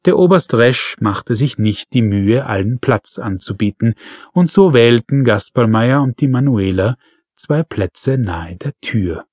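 plain reading voice

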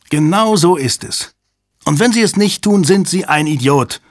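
high, forceful, worked-up voice; agitated insistence. A narrator's reading. Hale urging the commander to act, agitated and high.

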